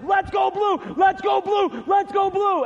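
high, loud sideline shouting; imitated screaming cheer. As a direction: shout it like a rabid soccer grandma. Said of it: "I can't even do it loud enough".

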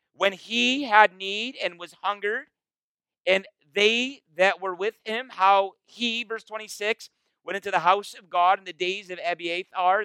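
a touch of solemn reading to the congregation; scripture reading. Acted. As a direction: read the verse with weight. Reads scripture aloud.

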